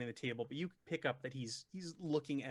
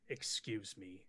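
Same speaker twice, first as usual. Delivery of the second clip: sighed, low brush-off; sigh, then dismiss them in a lower voice; annoyed sigh; 6 semitones lower, 5 dB quieter, slower than usual. Acted. Annoyed man excuses himself from a chatty stranger, sighing and low. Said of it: "he looks like he's annoyed".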